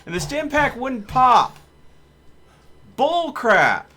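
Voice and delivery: loud, high angry gamer rant; real rage shouting at the screen; frustrated yelling. Real, not acted. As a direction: yell it out at the game, fed up. Gamer raging after losing fight.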